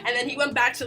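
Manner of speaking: ordinary delivery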